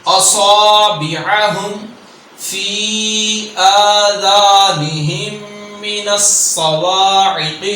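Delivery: slow chant with the saad held heavy; slow tajweed recitation; Quran recitation. Shown as a demonstration. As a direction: recite slowly, stretching each madd. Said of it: "دو حرکات کے برابر ہم لمبا کریں گے".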